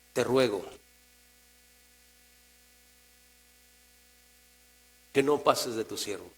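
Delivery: subdued, reverent begging; humble supplication. Acted. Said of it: "¿cómo llega el sadi-, el, el justo este?".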